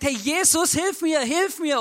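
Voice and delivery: loud, high, drawn-out cry for help; imitated shouting. Preacher retells blind Bartimäus calling Jesus, imitated shouting. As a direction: shout it out like the beggar, slowing on the plea. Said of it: "er steht auf und schreit los".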